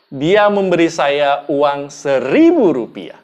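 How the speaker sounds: slow down and hit "seribu"; stress on "seribu". Shown as a demonstration. Speech trainer demonstrating the amount given, stress on "seribu". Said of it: "Anda bisa katakan".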